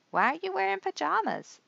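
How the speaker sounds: high-pitched puzzled question; Ernie's voice. A narrator's reading. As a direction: ask it like a wide-eyed little Ernie. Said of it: "Ernie asked the doctor".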